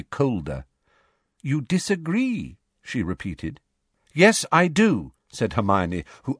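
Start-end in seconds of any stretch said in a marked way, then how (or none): 1.4-2.6 Umbridge voice. A narrator's reading. Umbridge repeats coldly. 4.1-5.1 clear carrying voice. A narrator's reading. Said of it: "clear, carrying voice".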